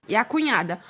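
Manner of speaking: ordinary delivery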